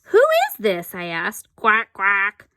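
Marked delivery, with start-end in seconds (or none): 0.0-1.0 high and loud; startled girl. A narrator's reading. Girl asking who's on the phone. 1.5-2.5 duck quacking. A narrator's reading. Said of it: "said a voice".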